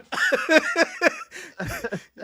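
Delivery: high cackling laugh; helpless burst of high laughter. Real, not acted. Co-host cracked up by Valley Girl impersonation, cackling.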